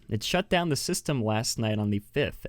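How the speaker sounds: usual speaking voice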